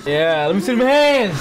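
hyped-up call to the crowd; rally the room for the team; hype call. Real, not acted. Friend cheering the Lions walkout, hyped call.

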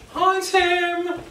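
very high squeaky hi; silly greeting. Pretend. Greeting Tim the pet, squeaky cartoon voice.

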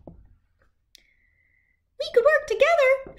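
very high, slow little squeak; a tiny shrew proposing teamwork. Acted. Shrew offers New to work together, tiny high voice.

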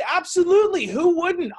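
high, loud burst of eager agreement; excited agreement. Retold or imitated. Speaker cheerfully agreeing with the students about ice cream, high excited. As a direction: jump in like you can't wait to agree.

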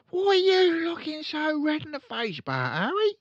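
high, loud fussy mum; mother voice calling; shrill mother voice. Acted. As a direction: call out to her red-faced husband.

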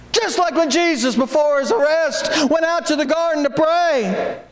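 ordinary delivery

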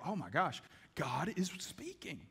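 slow, hushed, breathless wonder; awed whisper. Retold or imitated. Churchgoer whispers in awe that God spoke.

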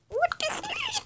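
sudden jump to a very high, thin voice; startled outburst. Real, not acted. Player jolted by the horror game's screen, startled high cry. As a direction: caught off guard, the words fly up.

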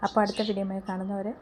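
hushed, near-whispered sign-off with a lost voice; strained, husky voice. Real, not acted. Vlogger asking viewers to subscribe, voice nearly gone, whispering. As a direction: whisper the outro, voice almost gone. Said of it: "ഒച്ച അടച്ചിരിക്കുകയാണ്".

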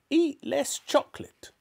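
high, strict granny voice; bossy grandmother. A narrator's reading. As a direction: lay down the law as an old woman. Grandmother ordering George about.

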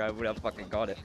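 normal talking voice

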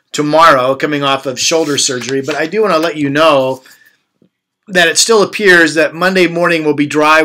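normal talking voice

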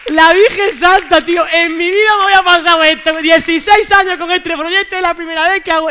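loud, high-pitched disbelief; real stunned shouting; astonished outburst. Real, not acted. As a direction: exclaim it in shock, drawing words out. Astonished outburst after the act.

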